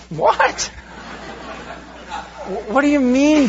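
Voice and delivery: a real startled laugh at the idea; half-laughing, can't believe what he heard; incredulous laugh. Real, not acted. Reacting to a misreading of Bible.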